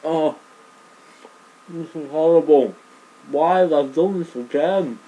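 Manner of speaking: loud, high, pained cry; pained wail. Real, not acted. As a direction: howl through the burning. Mouth burning from leek.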